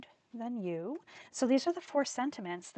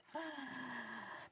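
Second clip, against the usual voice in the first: sharp gasp; 5 semitones higher, 12 dB quieter than usual. Real, not acted. Startled by her pattern.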